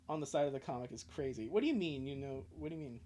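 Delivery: usual speaking voice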